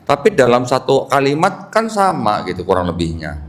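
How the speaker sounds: ordinary delivery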